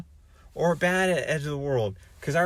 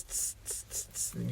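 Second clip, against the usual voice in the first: soft hissing tss sounds; a quiet unvoiced hiss; 4 semitones lower, 15 dB quieter than usual. Shown as a demonstration. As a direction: hiss tss tss through the teeth. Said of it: "You don't have that sound".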